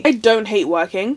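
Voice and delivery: ordinary talk, nothing marked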